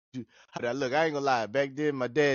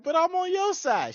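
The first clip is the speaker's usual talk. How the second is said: pitched-up pleading protest; high protest. Retold or imitated. Son to his stepmother, high pleading protest. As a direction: squeak it out, wounded. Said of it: "I'm like".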